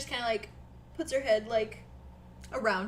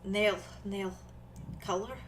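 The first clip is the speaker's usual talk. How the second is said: put-on halting accented character voice; Nori, shy and hesitant; 9 dB quieter than usual. Nori asks Julian about nail color, hesitant accent. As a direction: feel for the words in a put-on accent.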